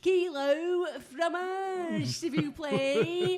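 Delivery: high, very slow French; drawn-out, high mock-French voice; exaggerated French accent. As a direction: drag out every French syllable comically.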